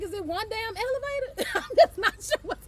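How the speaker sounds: high, laughing mock outrage at the line; laughing disbelief. To her audience about the Empire State line, laughing disbelief. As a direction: ask it while cracking up at the wait. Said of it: "I'm like".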